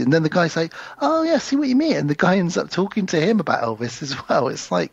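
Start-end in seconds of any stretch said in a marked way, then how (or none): 1.0-2.0 high mock voice. Imitating the clerk.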